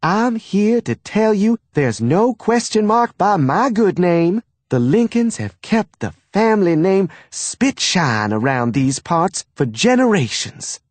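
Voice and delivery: high, proud Southern mother. A narrator's reading. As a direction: declare it with pride. Mother defending family name.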